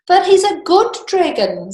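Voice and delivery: put-on high, pleading young boy; boy's voice. A narrator's reading. Boy begging the king to spare his dragon, high and pleading.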